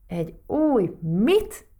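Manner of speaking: baffled question. A narrator's reading. Talking pebble staring at Matild over the news, baffled. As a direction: stretch it out in amazed puzzlement. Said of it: "bámult rá beszélő kavics".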